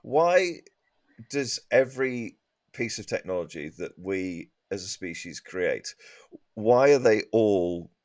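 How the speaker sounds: usual speaking voice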